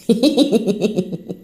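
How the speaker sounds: rapid tittering giggle. Real, not acted. Joking to listeners about her accent, giggle. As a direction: let out a string of giggles.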